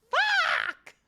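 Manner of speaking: high strained yelp of a swear; real exasperated cry; frustrated shout. Real, not acted. Frustrated at failed pronunciation.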